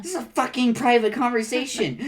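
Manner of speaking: high, indignant telling-off; indignant scolding. Acted. Defending a private conversation, outraged. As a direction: scold the eavesdropper at full pitch. Acted quarrel with an eavesdropper.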